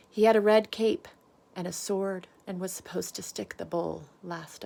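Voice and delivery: lively storybook voice, big swings up and down; animated read-aloud for a class; reading to children. A narrator's reading. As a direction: read it out like bedtime story time for little ones. Reads picture book to children.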